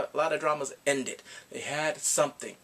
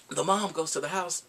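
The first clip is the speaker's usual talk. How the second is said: ordinary delivery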